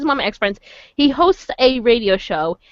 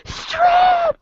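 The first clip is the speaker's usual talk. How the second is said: high-pitched mock heckle; retold yell. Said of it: "we were yelling at the con".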